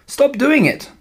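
high-pitched cry to stop; child's indignant outburst; upset protest. A narrator's reading. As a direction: plead sharply, pitch leaping up. Child crying out to stop bully.

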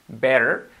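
American-style "better" with a flap; flapped T. Shown as a demonstration. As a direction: tap the T of "better" like a light Spanish R. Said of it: "la convertimos en una R suave".

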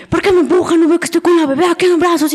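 mock outraged mother telling her off; indignant scolding woman.

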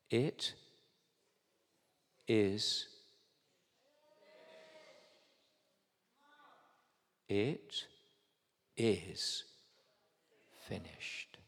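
weighty, softly measured proclamation; solemn declaration. Real, not acted. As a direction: let each word drop quietly into long silence. Solemnly delivering the three key words.

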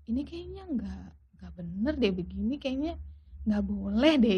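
soft, hesitant musing to oneself; murmured unease, as if thinking; retold inner thought. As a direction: quietly voice a doubtful thought.

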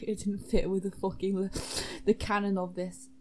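ordinary talk

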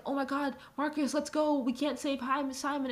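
high, hurried plea to run and leave Simon; hammed-up panicky urging to flee; lightly voiced bossy squadmate calling Marcus away; mock urgent North. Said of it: "North is like".

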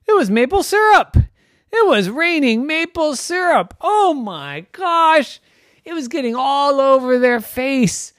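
loud, high, amazed storytelling; thrilled reveal. A narrator's reading. Storyteller revealing a maple syrup rain, thrilled. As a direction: burst out with the astonishing surprise.

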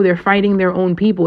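normal talking voice

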